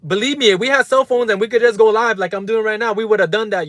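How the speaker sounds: real heated outburst, voice pushed up; angry rant. Real, not acted. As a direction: let the anger spill out at them. Ranting at doubters on stream.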